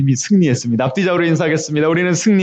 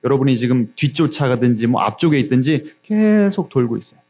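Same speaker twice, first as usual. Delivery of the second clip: normal talking voice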